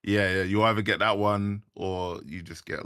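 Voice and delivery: ordinary talk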